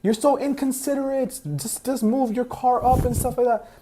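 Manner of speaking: high, slow imitation of an irate lady; mock yelling. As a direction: scold him in a drawn-out high voice. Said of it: "a lady yelling at him".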